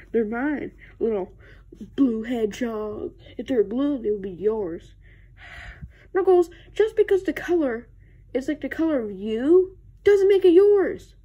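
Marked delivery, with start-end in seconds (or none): 6.1-11.1 louder, exasperated protest; raised-voice squabbling; exasperated arguing. Acted. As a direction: argue back, fed up. Acted quarrel over chips.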